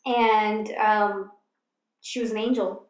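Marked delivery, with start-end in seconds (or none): 2.0-2.9 slipping into a country accent; a light, unplanned country accent; lightly countrified accent. Real, not acted. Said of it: "I just switched to country".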